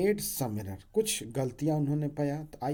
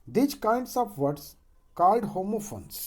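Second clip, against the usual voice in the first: slow dictation; 6 semitones higher, 5 dB louder, much slower than usual. Acted. Dictates definition slowly.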